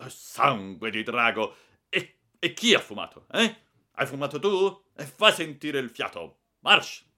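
loud, high furious tirade; thundering rage. Acted. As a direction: blast the boy with fury. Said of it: "tonò".

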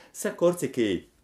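plain reading voice

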